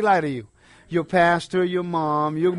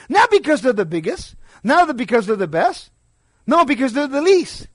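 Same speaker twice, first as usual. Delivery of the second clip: builds louder and higher to the least; rising emphatic preaching; 6 semitones higher, 4 dB louder than usual. Acted. Preacher building to the humble, rising emphatic. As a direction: preach it, climbing to the word least.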